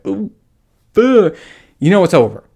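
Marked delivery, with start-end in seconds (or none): 0.0-1.4 high, loud imitated groan with a pulled face; mock moan. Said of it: "that face like".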